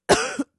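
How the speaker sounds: loud, high choking cough; exaggerated smog cough; hacking cough. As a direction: cough like the air is poison. Choking on polluted air in bit.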